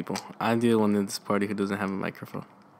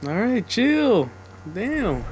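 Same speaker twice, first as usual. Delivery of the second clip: sarcastic; 10 semitones higher, much slower than usual. Real, not acted. Friend snaps, she mocks back.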